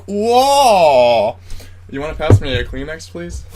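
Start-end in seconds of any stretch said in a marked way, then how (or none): 0.1-1.4 loud, high drawn-out whoa; big mock cry of being overwhelmed; re-enacted whoa. Drinker re-enacts getting distracted by floating bits, loud whoa. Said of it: "I was just like".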